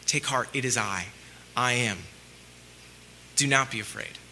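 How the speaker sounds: Jesus gently calming his terrified disciples; tender reassurance. Acted. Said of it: "He said".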